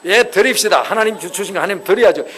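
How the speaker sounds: loud, eager wife saying yes; wife loudly agreeing to the offering; eager loud agreement. Retold or imitated. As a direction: shout it out gladly. Imagined Sara agreeing gladly.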